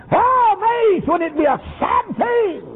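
high wailing cry; pastor's high lament; anguished cry. Retold or imitated. Pastor lamenting the lost boy.

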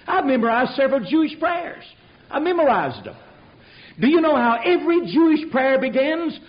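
high, emphatic pulpit delivery; forceful preaching. Real, not acted. Preacher challenges congregation over prayers without Jesus, forceful preaching. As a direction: drive each point home from the pulpit.

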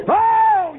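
high ecstatic cry of joy; shouted oh. Real, not acted. Preacher shouting at the Calvary victory, high joyful cry. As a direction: let out a soaring shout of praise.

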